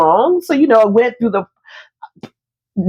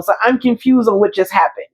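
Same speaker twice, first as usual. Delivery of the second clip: ordinary talk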